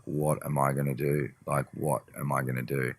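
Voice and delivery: low, very quiet despondent muttering; retold sadness. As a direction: sound quietly lost, retelling a low moment. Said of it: "the saddest fucking session I've ever done".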